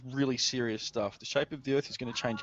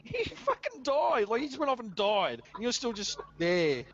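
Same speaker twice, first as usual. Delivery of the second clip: mock outrage; 8 semitones higher than usual. Mock outrage about Jesus.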